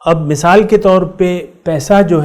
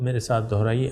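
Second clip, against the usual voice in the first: ordinary delivery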